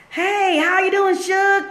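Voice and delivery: high, drawn-out singsong hello; friendly greeting. Acted. Acts out greeting a disliked person.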